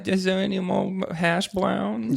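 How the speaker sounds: put-on high, slow, babyish ask; cutesy baby voice. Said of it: "say in a baby voice".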